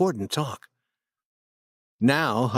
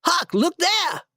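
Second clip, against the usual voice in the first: mock shout.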